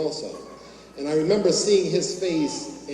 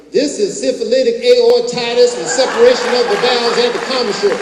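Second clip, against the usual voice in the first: loud, high-pitched Southern professor impression; southern drawl; 7 semitones higher, 10 dB louder than usual. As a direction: proclaim it in a big Southern drawl. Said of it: "with his southern drawl say".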